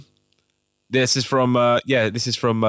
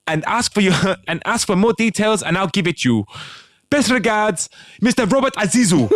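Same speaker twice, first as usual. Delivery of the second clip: mock West African accent; 9 semitones higher, 4 dB louder than usual. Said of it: "I'm gonna do an accent".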